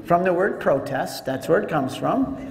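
ordinary delivery

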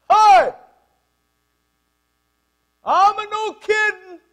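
booming, high, drawn-out Italian shout; irate Italian bellowing through the house; Italian accent yell. Retold or imitated. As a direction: holler it loud and slow with an Italian accent. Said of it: "That's Italian".

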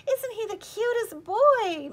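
high, loud, slow cooing; doting old lady cooing at a toddler; gushing granny. A narrator's reading. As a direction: coo over the baby like a doting grandma. Grandmotherly guest gushing over baby.

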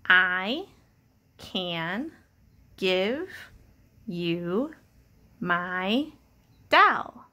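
each word spaced out slowly, pausing between; word-by-word reading. Shown as a demonstration. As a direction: read it one word at a time for a child. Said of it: "Let's read it together".

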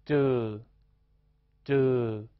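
这 held long, gliding downward; second tone. Shown as a demonstration. Teacher demonstrates second tone, 这 long and falling. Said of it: "加上长元音呃加二声".

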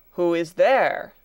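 loud, slow, booming demand; thundering challenge. A narrator's reading. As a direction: thunder it out down an echoing hall. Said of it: "thundered the doctor, in a voice that echoed through the hall".

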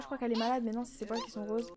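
ordinary talk, nothing marked

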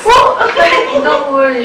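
a daughter delighted that the car will be released; relieved laugh. Acted. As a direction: giggle out your thanks.

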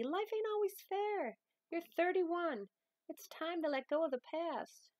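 a hint of a grandmother, firm then tender; lightly voiced firm Grams. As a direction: gently hold the line with a hurting granddaughter. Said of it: "I'm gonna probably use a little bit of voices".

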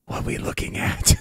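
in-character whisper; hushed, voiceless question. Acted. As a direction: whisper it from right behind someone. Character crept up behind praying cultist.

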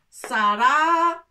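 "Sara" loud and drawn out; sounded out. Shown as a demonstration. Teacher sounding out the first syllables loudly. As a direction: call it out long for the class. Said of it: "avec kasra".

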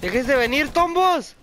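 high, loud taunt at enemies; taunting shout. Real, not acted. Gamer taunting enemy players, high and loud. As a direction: dare them to come at you.

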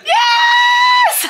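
real shrieking delight, breaking into laughs; ecstatic cheer. Real, not acted. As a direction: scream it out in celebration. Celebrating the dancers' perfect score.

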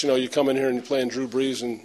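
usual speaking voice

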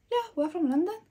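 high, mock-British reply; very British accent. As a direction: imitate a London shop assistant's British accent. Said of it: "with the most British accent".

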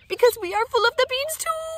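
very high, loud little pup voice; squeaky tiny dog voice. Acted. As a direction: squeak it out like the tiniest chihuahua.

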